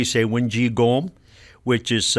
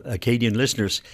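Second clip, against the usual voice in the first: usual speaking voice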